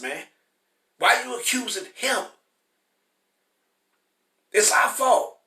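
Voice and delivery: fiery preaching. Acted. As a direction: scold the congregation hard. Preaching at full force.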